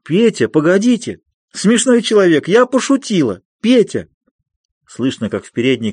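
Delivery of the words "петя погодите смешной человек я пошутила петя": loud and high, calling after someone. A narrator's reading. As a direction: shout it after him as he leaves. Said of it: "кричит вслед".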